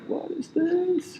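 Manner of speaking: high, very slow baffled squeak; mock baffled girl. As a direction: play it stretched out and puzzled. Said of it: "they're like".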